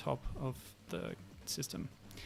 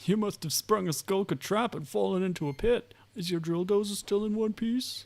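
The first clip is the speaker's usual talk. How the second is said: put-on high, loud old man voice; 7 semitones higher, 6 dB louder than usual. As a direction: ham up a creaky old geezer. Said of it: "That was my old man voice".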